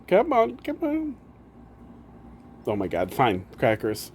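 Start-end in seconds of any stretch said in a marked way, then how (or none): 0.1-1.2 much higher, slow sing-song coaxing; high coaxing pet voice. Real, not acted. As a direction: coo it up high to lure the cat. Coaxing the cat to climb up.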